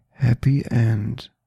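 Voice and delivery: ordinary delivery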